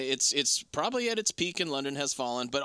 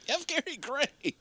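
mock announcer; 12 semitones higher than usual. Shown as a demonstration. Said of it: "What the fuck?".

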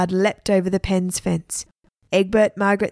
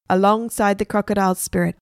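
ordinary delivery, nothing marked

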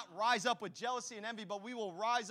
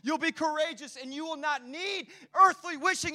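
rising preaching shout; 6 semitones higher, 9 dB louder than usual. Real, not acted. Preacher builds to a climax.

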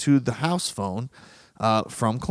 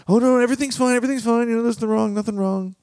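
nice and peachy; 9 semitones higher, much faster than usual. Retold or imitated. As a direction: cover up the fight with forced brightness. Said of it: "nice and peachy".

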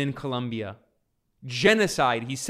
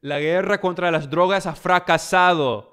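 louder Spanish, fracasado hit hard; Spanish quote; 3 semitones higher, 5 dB louder than usual. Retold or imitated. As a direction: read the Spanish like a solemn declaration. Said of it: "He says".

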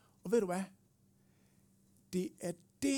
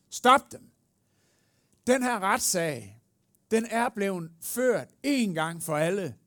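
loud, raised-pitch courtroom objection; advocate's commanding shout; 5 semitones higher, 8 dB louder than usual. Acted. The advocate bursting into court to silence accusations, shouting. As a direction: halt the accusers with full force. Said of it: "Og han råber".

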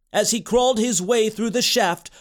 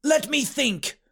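put-on small child puzzling it out; little boy; 5 semitones higher than usual. A narrator's reading. Boy answers his trapped uncle, little boy voice. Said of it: "replied the little magic boy".

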